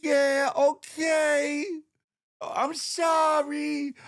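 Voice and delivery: put-on high, slow little kid voice; adorable kid voice. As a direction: sound like a cute small child. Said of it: "they be sounding all adorable".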